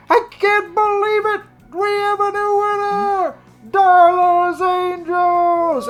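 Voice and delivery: high, loud stadium announcer shout, last words stretched out; excited announcer. Acted. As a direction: bellow the win to a roaring crowd. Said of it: "you hear the announcer".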